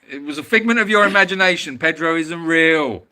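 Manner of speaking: mock stern telling-off. Teasing the viewers who keep asking about Pedro, mock stern. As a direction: wag a finger at the chat, half joking.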